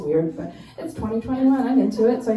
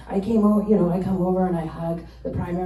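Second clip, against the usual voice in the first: ordinary talk, nothing marked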